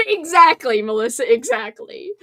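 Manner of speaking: normal talking voice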